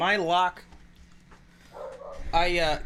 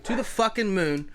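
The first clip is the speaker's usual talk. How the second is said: excited; 6 semitones higher than usual. Real, not acted. Hyping his over bet.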